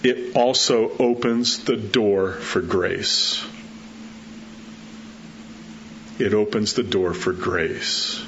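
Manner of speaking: slow, soft stress on the; earnest slow repetition; slow emphasis. Real, not acted. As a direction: linger on the door for grace. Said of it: "listen to me".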